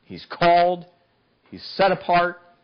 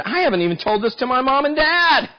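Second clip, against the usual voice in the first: indignant Samson; 12 semitones higher than usual. Acted. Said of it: "He said".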